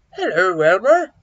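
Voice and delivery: big, loud hippo greeting; storybook hippo calling out loudly. A narrator's reading. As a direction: boom out a hello as a hippo. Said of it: "Hello, Hippo".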